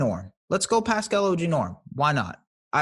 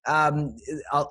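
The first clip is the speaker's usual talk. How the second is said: ordinary delivery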